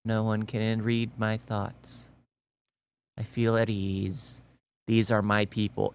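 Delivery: low, slow, hushed contentment; blissfully soothed believer. Pretend. Commentator voicing a tinfoil-hat wearer, mock serene. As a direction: imitate someone sinking into soft, slow relief. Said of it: "Be like".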